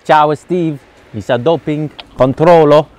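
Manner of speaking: playful mock Italian accent. Greeting his friend Steve in mock Italian accent. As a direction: ham up broken Italian for a laugh. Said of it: "Italian style".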